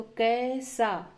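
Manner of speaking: कैसा slowly, with a drawn-out a-to-e glide; ai vowel. Shown as a demonstration. Teacher showing the double-stroke vowel sign, long ai in कैसा. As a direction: stretch the first syllable of कैसा as ai. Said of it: "ऊपर दो मात्रा है तो".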